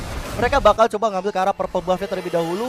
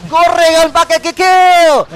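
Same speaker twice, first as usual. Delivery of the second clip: stretched, loud hype call; hype shout; 8 semitones higher, 10 dB louder, much slower than usual. Acted. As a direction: belt it out like a catchphrase. Caster's punning hype catchphrase.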